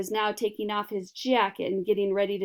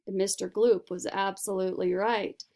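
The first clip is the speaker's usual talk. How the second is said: usual speaking voice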